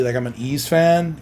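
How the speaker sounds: usual speaking voice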